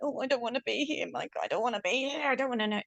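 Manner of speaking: high, quick mock pleading; frightened protest. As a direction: act out a reluctant, scared protest. Acting out her own frightened protest.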